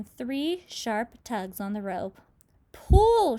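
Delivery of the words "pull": much higher, much louder barked order; shout. Acted. Grasshopper orders everyone to haul the rope, huge shout. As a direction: yell the command with all your force. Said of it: "shouted the old green grasshopper".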